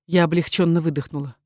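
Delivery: ordinary delivery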